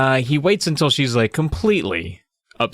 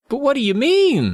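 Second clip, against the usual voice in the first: put-on squeaky high girl's voice; pleading girl; 13 semitones higher than usual. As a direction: play Carly Beth as a whiny girl. Playing Carly Beth pleading.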